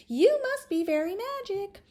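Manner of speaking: put-on doll children amazed. Doll kids amazed at Charlotta, high awed voices. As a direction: marvel at her like a toy child. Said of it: "said the doll boy and the doll girl".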